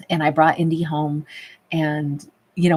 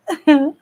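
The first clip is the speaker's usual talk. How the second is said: short high, louder burst of laughter; delighted giggle; 10 semitones higher, 7 dB louder than usual. Real, not acted. Streamer giggling while greeting viewers, delighted.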